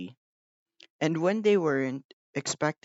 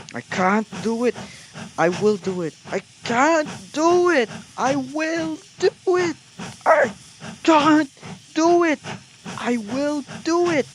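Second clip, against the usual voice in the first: building, much higher and louder; puffing; 12 semitones higher, 8 dB louder than usual. A narrator's reading. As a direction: chug it out, straining harder. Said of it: "they puffed together".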